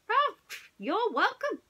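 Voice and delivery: high character voice for the groundhog; the groundhog replying politely; Golfer's voice. A narrator's reading. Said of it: "replied Golfer".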